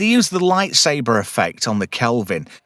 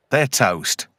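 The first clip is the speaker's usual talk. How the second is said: ordinary delivery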